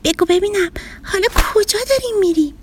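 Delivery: put-on high, light little boy voice; a small boy asking his dinosaur friend; child voice. Boy Sina asks the dinosaur, high child voice.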